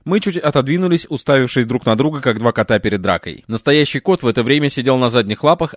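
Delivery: usual speaking voice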